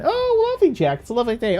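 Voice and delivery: very high, silly falsetto reply; squeaky put-on politeness; answering oneself. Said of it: "have a conversation with yourself".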